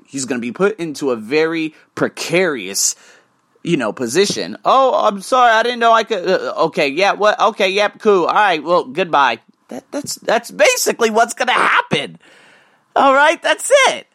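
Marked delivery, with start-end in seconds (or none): 4.6-9.4 much higher, louder, stammering mock panic; flustered Luke. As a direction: fumble like a caught man trying to escape. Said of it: "What could Luke possibly say?".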